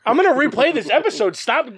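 high and loud. Real, not acted. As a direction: raise your voice playfully. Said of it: "you're so loud".

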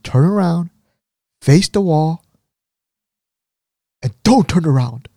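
firm, lifted commands with pauses; stern orders. Retold or imitated. Head nurse ordering the husband to face away, stern. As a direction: order him about like a strict nurse. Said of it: "she looks really stern".

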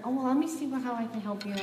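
a touch of flustered eagerness to help; nervous clerk. Retold or imitated. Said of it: "she was really nervous".

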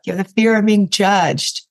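usual speaking voice

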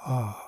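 soft exhaled sigh of relief; relaxed letting-go sigh; sigh of release. Shown as a demonstration. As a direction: let the breath fall out softly. Sighs as tension is released.

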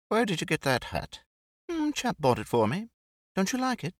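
airy, amused society lady; Brett, light and teasing. Acted. Narrator voices Brett chatting with Jake and Mike, amused. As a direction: tease him lightly about the hat.

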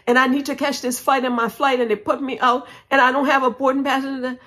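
high, rushed, run-on babble; incoherent panic. Retold or imitated. Traveler begs airline man about her flight, frantic babble. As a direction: spill it all out without a breath, imitating panic. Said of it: "and I wasn't coherent".

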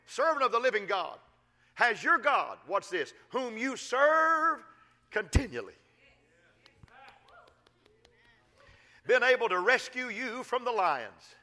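a king calling out, high and loud with anguish. Retold or imitated. King Darius at the lions' den calling for Daniel, anguished. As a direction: cry out to Daniel, stretching whom you serve slowly. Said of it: "he called to Daniel in an anguished voice".

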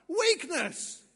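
loud, high one-word shout; emphatic punchline. Real, not acted. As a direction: hit the answer at full force. Preacher shouts the key word.

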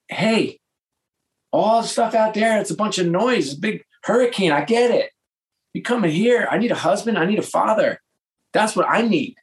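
high-pitched exasperated wife impression; imitated scolding wife. Wife demanding a husband and father, imitated firm scolding.

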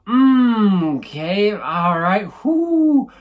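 wincing hum, then composing himself with a whoo; loud, high pained "mm", reining it in; controlled pain response. Acted. Host demonstrating a thought-out response to a stubbed toe, controlled pain. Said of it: "I would raise it up and go".

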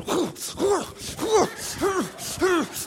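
put-on repeated high yelps, flailing child; short rhythmic whoops, like a thrashing toddler; imitated tantrum noises. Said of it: "on the bed just going".

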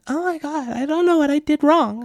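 high, hurt wife voice; upset wife voice. Retold or imitated. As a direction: play the wounded wife.

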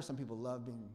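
ordinary talk

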